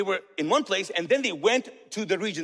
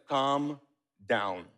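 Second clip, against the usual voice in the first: calming Jesus; 7 semitones lower than usual. Retold or imitated. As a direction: settle the panic gently. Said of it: "Jesus gets up and goes like".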